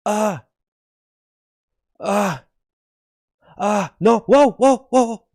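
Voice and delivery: high yelps building to loud whoas; mock alarm. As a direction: pretend fright at nearly falling. Phone face when being dropped.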